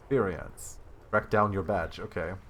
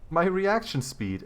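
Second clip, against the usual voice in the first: "my reaction speed" said higher and slow through a laugh; 8 semitones higher, slower than usual. Real, not acted. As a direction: let a laugh break through the words. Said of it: "it failed me".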